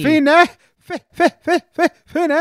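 high, squeaky stuttered Feeney; mock stutter. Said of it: "You think he gets that a lot?".

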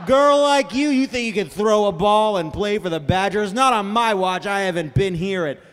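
movie villain. Acted. Villain telling girl she can't join the team, hammed-up scorn. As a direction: scoff like a sports-movie bad guy. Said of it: "seems like he's giving the speech in a movie where a villain".

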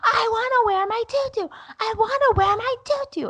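put-on very high, squealing little-girl wail; mock tearful toddler tantrum; imitating whining toddler. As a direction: nag like a toddler in meltdown. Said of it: "And Matilda's in my ear going".

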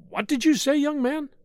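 put-on high, scandalised older woman; shocked, high-pitched demand; indignant mother-in-law. A narrator's reading.

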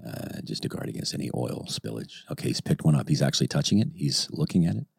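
quiet golf-style commentary; low, hushed play-by-play; hushed mock sports commentary. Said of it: "Let me commentate as he does this".

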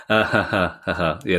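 nervous laugh into yeah; laughter turning nervous. Real, not acted. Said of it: "that's my nervous laugh in case lawyers are listening".

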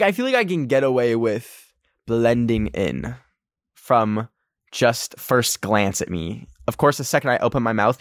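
usual speaking voice

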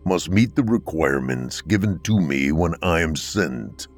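deep, empathetic Death. Acted. Death explaining to the husband, deep and empathetic. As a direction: speak as a gentle Death. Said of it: "Death carried an empathetic tone despite the depth of his voice".

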